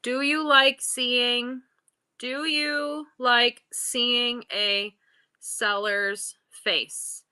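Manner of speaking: very slow, words drawn out and spaced; dictating while typing. Real, not acted. Seller typing a poll for viewers, slow and halting. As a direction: stretch each word as if typing it out.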